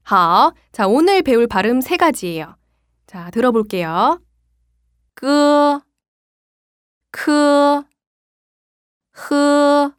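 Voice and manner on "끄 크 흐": 끄 크 흐 pulled from the back of the throat; 끄 크 흐 raised from the throat, like spitting; throat sounds demo. Shown as a demonstration. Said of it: "이 발음을 쉽게 설명하자면".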